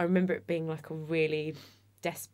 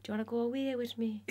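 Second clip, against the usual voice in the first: put-on Irish accent; 6 semitones higher, 4 dB quieter, faster than usual. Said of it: "I can't do an Irish accent".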